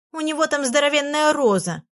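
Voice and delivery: выше, с ехидцей жалуется; наябедничай на друга; ябедничая. Acted. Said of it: "наябедничала Фенечка". (ябедничает подруге, звонко и выше)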